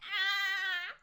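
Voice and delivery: ordinary talk, nothing marked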